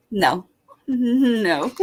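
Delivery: normal talking voice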